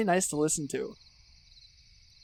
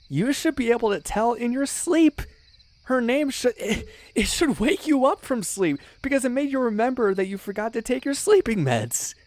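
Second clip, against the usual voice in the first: rising, higher-pitched exasperated rant; excited rant; 9 semitones higher than usual. Acted. Scolding a friend for not knowing her, excitedly. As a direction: pile it on, getting more worked up. Ranting at friend about favourite VA.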